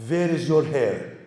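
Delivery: put-on slow Persian-accented question, w as v; Persian grandma accent. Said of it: "I can't do the accent well enough".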